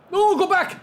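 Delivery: high, loud urgent yell; panicked shout. Real, not acted. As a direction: shout at the screen in alarm. Panicked call during play.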